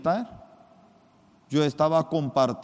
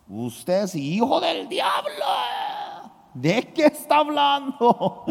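mock angry shout; 11 semitones higher than usual. Soldier arguing with his superior, retold angry shout. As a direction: hurl the accusation at the officer. Retold heated argument with officer.

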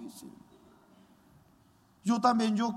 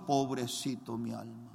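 low, soft, sorrowful murmur; quiet lament; 10 semitones lower, 8 dB quieter than usual. Retold or imitated. Boy weeping over his own soul, quiet lament. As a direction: grieve for his soul under his breath. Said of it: "Yo lloraba mucho".

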